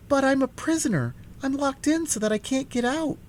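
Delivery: put-on high, pleading little-girl voice. A narrator's reading. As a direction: beg from the tower to be let out. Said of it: "she pleaded".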